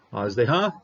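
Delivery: Hazdeha with the shva silent, haz-de-HA, rising at the end; Hazdeha, closed first syllable, rising finish; Hebrew pronunciation demo. Shown as a demonstration. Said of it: "This one is pronounced Hazdeha".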